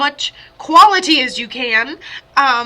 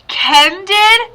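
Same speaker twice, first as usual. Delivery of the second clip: sudden gasp of realization; surprised; 9 semitones higher, 5 dB louder, much slower than usual. Real, not acted. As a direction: react with real surprise, voice jumping up. Sudden realization during reading.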